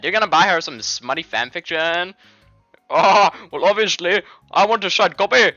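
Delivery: high, loud mocking Indian accent; badly faked Indian accent. Friends joking about her writing, in a loud fake Indian accent. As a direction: ham up a teasing Indian accent. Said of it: "fucking Indian man's voice instead".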